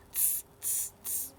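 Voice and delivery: put-on soft hissed tss for the kids; teacher shows children the monkey tss; monkey tsk. Shown as a demonstration. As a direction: show the kids the monkey sound. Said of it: "What did they say, girls and boys?".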